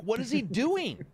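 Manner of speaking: high, drawn-out baffled complaint; incredulous, stretched-out question; mock exasperation. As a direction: act out disbelief at a friend's antics. Retelling exasperation in game.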